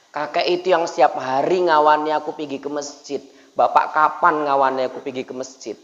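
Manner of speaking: low-pitched, restrained reproach. Acted. Son reproaching father about going to the mosque, subdued. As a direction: ask it as a subdued, hurt child. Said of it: "sebelum anak protes".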